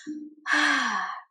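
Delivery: long audible breath out; deep relieved sigh. Shown as a demonstration. Spouse feels helped and understood, deep sigh of relief. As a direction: let out a demonstrated deep breath. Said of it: "Deep breath".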